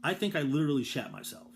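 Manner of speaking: ordinary talk, nothing marked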